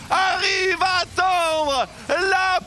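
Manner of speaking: slow, emotional cheering; overjoyed shout. Real, not acted. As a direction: voice nearly breaking with joy. Célébration de la perfection des T1. (cri de joie ému)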